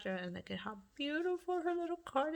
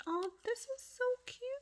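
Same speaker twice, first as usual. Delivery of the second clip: much higher, rising so cute; delighted squeal; 13 semitones higher than usual. Real, not acted. Crafter thanking Samantha for the card, delighted. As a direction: gush over a cute card.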